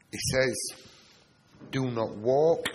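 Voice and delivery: ordinary delivery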